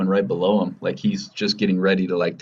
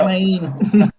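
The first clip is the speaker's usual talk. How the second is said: silly high voice; 11 semitones higher, 6 dB louder than usual. Pretend. Airplane impression joking.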